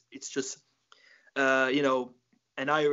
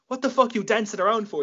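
mock yell; 8 semitones higher, 5 dB louder than usual. Paddy Scotsman shouts at dancing neighbor.

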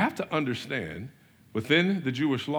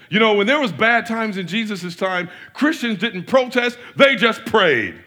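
higher, louder, slowing mock pompous preacher; mocking the pastor; 7 semitones higher, 7 dB louder than usual. Mocking a famous pastor's quote to the congregation, higher, louder and slow. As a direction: lampoon the famous pastor's pious line. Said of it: "he said".

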